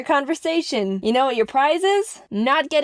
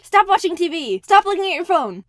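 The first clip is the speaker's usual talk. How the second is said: insistent shouting; 7 semitones higher, 4 dB louder than usual. Acted. Demanding attention.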